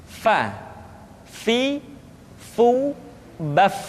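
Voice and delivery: airy f in "fa, fi, fu, baf"; souffle doux. Shown as a demonstration. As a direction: let air flow gently through the f of "fa, fi, fu, baf". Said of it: "Suara dan angin mesti keluar dengan lembut".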